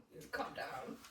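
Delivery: hushed reminder to herself while reading; quiet self-scolding. Real, not acted. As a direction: say it under your breath.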